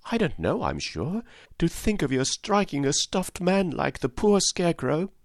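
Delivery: put-on high-pitched indignant child; scolding girl. A narrator's reading. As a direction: scold in a little girl's high voice. Dorothy keeps scolding the lion.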